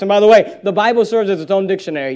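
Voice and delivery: normal talking voice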